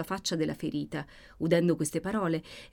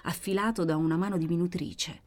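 ordinary delivery, nothing marked

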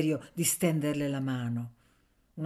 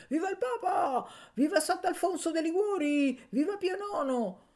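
crowd cry; 13 semitones higher than usual. A narrator's reading. Students hailing the Pope in a noisy lecture hall, crying out. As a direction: cry it out high, like a rowdy acclamation. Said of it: "grida di".

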